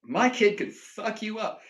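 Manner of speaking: mock bragging. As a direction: brag about your kid, hammed-up. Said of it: "I would brag about that".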